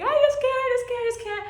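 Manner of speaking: high, breathless sobbing plea; hyperventilating cry. Retold or imitated. Retelling her breakdown to viewers, high sobbing. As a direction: gasp it out like an ugly cry. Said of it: "you're hyperventilating".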